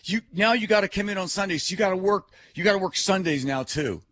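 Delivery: hammed-up boss laying down the law; Dolly punishing the stand-in; mock scolding boss. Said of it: "So then she said".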